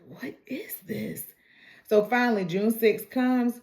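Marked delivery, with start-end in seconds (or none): 0.1-1.2 imitated, hushed bewilderment; retold whispered disbelief; baffled whisper. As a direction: whisper it like a puzzled man to himself. Teller voices a baffled man.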